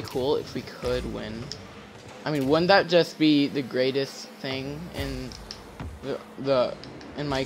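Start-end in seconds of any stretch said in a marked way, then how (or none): none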